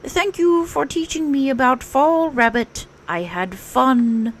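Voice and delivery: a happy little bear calling back as he leaves; Pooh calling back. Acted. Pooh calling thanks back to Rabbit down the path. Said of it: "called back".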